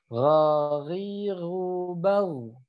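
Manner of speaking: Ghariru bau very drawn out, gh rasped; ghain berdengkur. Shown as a demonstration. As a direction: chant Ghariru bau slowly with a snoring gh. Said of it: "Harus ada dengkurnya". (Ghariru bau panjang, gh berdengkur)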